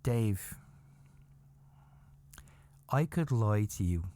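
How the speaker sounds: low, measured delivery with long pauses; mock-solemn retold confession, pitched low; imitating grave wife. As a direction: break it to him gently but gravely, as the wife. Retells wife's grave answer.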